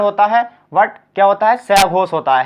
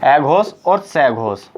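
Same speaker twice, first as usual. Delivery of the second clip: slow, lower; 5 semitones lower, much slower than usual. Shown as a demonstration. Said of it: "अघोष और सहघोष के बारे में बता दूँ".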